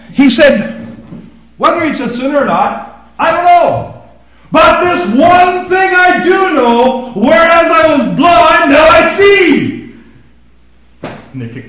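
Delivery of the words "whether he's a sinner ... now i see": loud, high, very slow proclamation; triumphant declaration. Acted. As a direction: ring it out like a testimony. Healed man defies the leaders.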